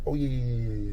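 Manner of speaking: ordinary talk, nothing marked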